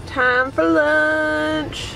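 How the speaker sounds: very slow, stretched sing-song; drawn-out announcement. Real, not acted. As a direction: stretch every word out. Announcing lunch.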